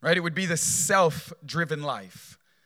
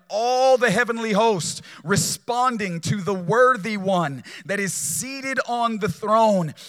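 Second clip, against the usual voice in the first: rising, louder preaching on God's majesty; preaching build; 4 semitones higher, 4 dB louder than usual. Acted. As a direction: swell toward the throne, slowing on he rules.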